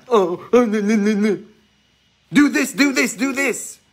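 high, loud fretting voice pushing people; mock fussy churchgoer. Preacher imitating a pushy believer, high and loud. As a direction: mimic a busybody insisting do this.

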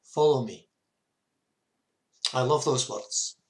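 ordinary talk, nothing marked

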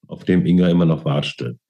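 ordinary delivery

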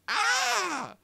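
high, loud exasperated shriek; real shriek of exasperation; frustrated scream. Real, not acted. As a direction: let out a short wail of frustration. Frustration after abrupt ending.

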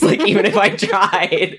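laughing through the words; giggling while talking. Real, not acted. Laughing at own forgetfulness.

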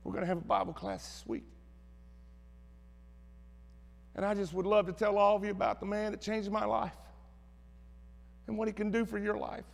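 put-on a touch of a timid girl's quiet voice; mousy voice. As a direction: quietly play a nervous girl speaking up. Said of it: "in that little mousy voice".

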